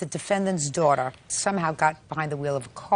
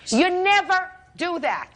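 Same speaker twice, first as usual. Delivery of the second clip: much higher and louder, slow; scolding emphasis; 11 semitones higher, 4 dB louder, slower than usual. Real, not acted. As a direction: hammer home the rule. Judge lectures litigant.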